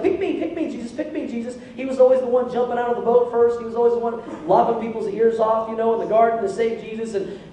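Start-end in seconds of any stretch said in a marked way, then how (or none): 0.0-1.6 high, eager "pick me" plea like a keen schoolboy; eager pleading. Retold or imitated. Pastor voicing the disciple begging Jesus to pick him, high and eager. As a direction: beg to be chosen, bouncing with eagerness. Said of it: "he was like".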